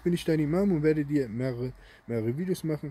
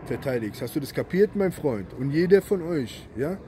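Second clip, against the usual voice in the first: raised, emphatic angry voice; angry warning; 2 semitones higher, 3 dB louder than usual. Real, not acted. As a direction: lay down the law, hammering stressed words. Angry warning to Sharia defenders. (wütend, laut und drohend)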